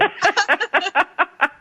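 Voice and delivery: high, bright peal of real laughter; high burst of laughter. Real, not acted. Host laughing at her own mistake, high peal of laughter.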